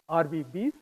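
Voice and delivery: "Are we bees?" for the letters R V B, said as a question with a small rise on "bees"; "Are we bees?", spoken softly with a light rising lilt; RVB read as a phrase. Shown as a demonstration. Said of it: "I read it as".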